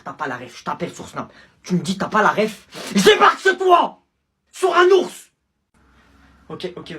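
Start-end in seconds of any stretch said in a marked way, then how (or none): 1.6-5.3 high, loud shrieked threat; over-the-top yelled menace; comic screaming threat. Acted. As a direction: explode into absurd rage. Absurd escalating threat.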